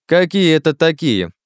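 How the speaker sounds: loud, high, drawn-out question; affronted challenge. Acted. Klazen squares up to Miller, loudly affronted. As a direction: challenge him, voice pitched high.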